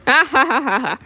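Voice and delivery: high-pitched put-on ha-ha; staged burst of laughter; acted laughter. Character laughs at the story.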